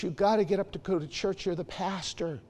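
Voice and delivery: lightly voiced nagging mom, high; a hint of mom's scolding; mother nagging. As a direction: remind him he's the pastor. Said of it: "the mother just saying".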